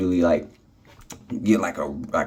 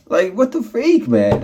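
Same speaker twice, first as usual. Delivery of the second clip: high, drawn-out exasperated cry; exasperated disbelief; 11 semitones higher, 3 dB louder, slower than usual. Real, not acted. Vlogger venting to viewers about fruit flies, high-pitched exasperation.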